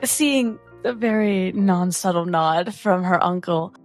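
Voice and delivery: ordinary delivery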